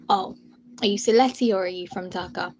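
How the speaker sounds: ordinary talk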